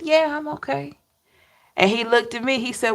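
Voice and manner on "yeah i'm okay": light higher voice for grandma, gentle and reassuring; a touch of an elderly grandmother's voice; grandmother's reply. Retold or imitated. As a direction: voice grandma softly saying she's fine. Grandmother's answer voiced in story.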